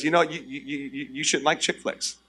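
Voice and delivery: hushed, low-key telling-off; disapproving inner voice. Acted. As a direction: say it quietly, stumbling on "you". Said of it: "A mold starts to form that says".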